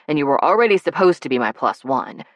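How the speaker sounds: fast, breathless rush; words tumbling out. Acted. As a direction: hurry through it nervously. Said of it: "His words were tumbling over one another".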